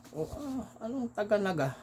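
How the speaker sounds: high, scoffing disbelief; mock disbelief. Naga fraternity man scoffs at the passenger, in high disbelief. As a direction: sneer it up high, unconvinced.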